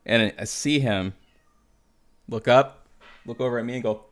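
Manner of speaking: usual speaking voice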